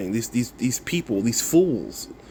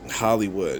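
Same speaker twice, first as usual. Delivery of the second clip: usual speaking voice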